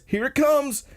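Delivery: very high mock squeal; excited cheerleading. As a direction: pitch way up like excited friends. Voicing the friends' excited sign.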